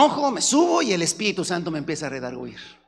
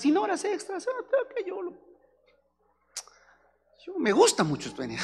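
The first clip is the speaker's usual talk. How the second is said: mock high bumbling voice; 12 semitones higher, 5 dB quieter than usual. Voicing a bumbling worker.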